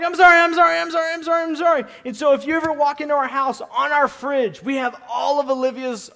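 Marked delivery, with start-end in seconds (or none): 0.0-1.8 a touch of frantic, higher repeated sorrys; dad begging his daughter's forgiveness; hurried apologies. Retold or imitated. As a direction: plead to calm a crying toddler.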